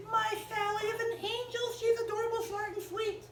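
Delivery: high, gushing proud mother; doting mommy. Acted. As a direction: gush like an indulgent mom. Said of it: "this is Mommy says".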